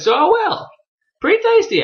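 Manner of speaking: very high, cheeky retort; hammed-up high-pitched insistence; mock defiance. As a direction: imitate a cocky kid, voice shooting up. Voicing defiant boy eating dragon.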